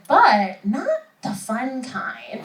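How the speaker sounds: slow, flat-out delivery of the punchline; deadpan doctor. Retold or imitated. Comic retells doctor's diagnosis, slow and dry. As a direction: let the doctor drop it dryly and slowly. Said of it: "And then she said".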